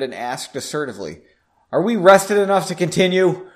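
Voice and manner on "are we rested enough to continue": firm, louder question; Henry pushing the group to move on, assertive. Acted. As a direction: demand it like a leader. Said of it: "See, that was assertive".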